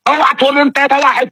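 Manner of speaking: high, mocking imitation of a debater; shrill-free mock debater, high and taunting; mocking debate style. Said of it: "شوف أسلوب المناظرة".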